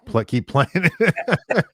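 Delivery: high, slowed words broken by laughter; giggling as it comes out; laughing. Real, not acted. Laughing while recalling replays.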